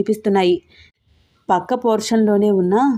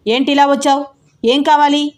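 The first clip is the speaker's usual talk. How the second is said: high, loud angry challenge; loud demand; 6 semitones higher, 3 dB louder than usual. Acted. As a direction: shout it at the intruding boy. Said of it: "అని గట్టిగా అడుగుతున్నాడు".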